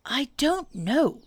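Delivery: high, slow, drawn-out puzzlement; rather doleful. A narrator's reading. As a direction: answer slowly, a touch mournful. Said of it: "said Pooh rather dolefully".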